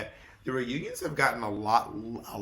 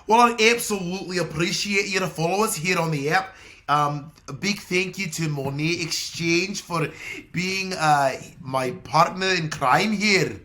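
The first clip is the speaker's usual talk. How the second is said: loud put-on Scottish-Irish brogue; Scottish-Irish accent; 4 semitones higher, 5 dB louder than usual. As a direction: give the thanks in a playful Scottish-Irish accent. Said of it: "that was a little Scottish".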